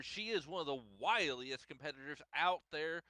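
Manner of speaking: usual speaking voice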